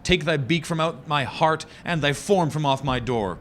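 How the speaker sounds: hammy stage actor. Acted. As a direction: scream the raven away from the door. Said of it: "I shrieked upstarting".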